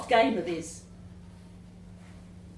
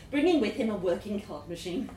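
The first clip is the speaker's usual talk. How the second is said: ordinary delivery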